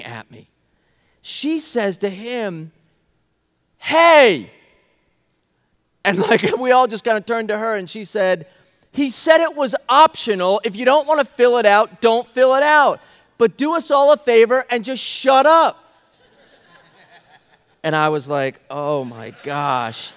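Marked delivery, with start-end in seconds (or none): 3.8-4.5 loud, high-pitched call to cut someone off; sharp shouted interjection. Retold or imitated. Lady interrupts a man screaming at the speaker, shouted. 8.9-15.8 loud, high, emphatic telling-off; retold scolding. Lady tells off the man ranting over surveys, loud scolding. 18.7-20.1 stunned disbelief. Retold or imitated. Stunned reaction to the outburst.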